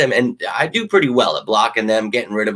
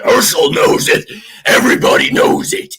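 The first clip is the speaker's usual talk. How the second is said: hyped shout; 12 semitones higher, 6 dB louder than usual. Real, not acted. Hyped rant to fans.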